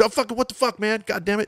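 mock high-pitched cursing fit; Jeff Gomes. Best buddy cursing at the bandleader, imitated yelling. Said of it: "just yelling at me like Jeff does".

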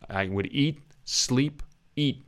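teacher's American "eat" with a swallowed T; American accent demo. Shown as a demonstration. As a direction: say it American with the T barely there. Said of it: "You hear it?".

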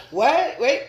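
high, loud imitated shock; mock alarmed reaction. Guest imitates host's face on hearing radioactive, mock alarm. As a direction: blurt it out like a startled client. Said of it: "I see your face like".

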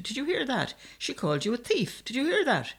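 high, indignant exclamation; high-pitched tattling shout. A narrator's reading. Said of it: "the younger man shouted".

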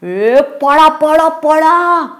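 high, drawn-out warning cry; alarmed yell. Acted. Damu warns his friends to run, high alarmed yell. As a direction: call to everyone to flee, voice held high. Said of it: "Damu ओरडता क्षणीच".

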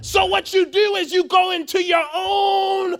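loud, high, impassioned preacher; full-force preaching. Real, not acted.

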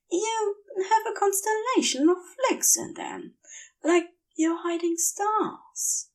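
put-on a giddy angel drunk on venom, gushing; tipsy Aziraphale. Aziraphale dreamily compliments Crowley.

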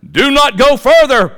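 high, loud warning call; donkey's loud warning. Acted. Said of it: "It's like".

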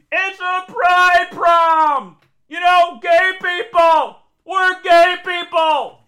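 very slow, high, booming holler; imitated drawn-out yell; shouting to explain. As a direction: bellow each word across the hall.

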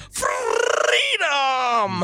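high, drawn-out battle cry; hammed-up war cry with a long rolled R; Mel Gibson. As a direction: trill the R and stretch it out high. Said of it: "so did Mel Gibson".

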